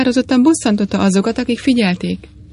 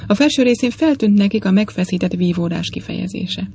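ordinary delivery, nothing marked

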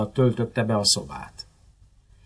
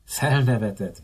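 usual speaking voice

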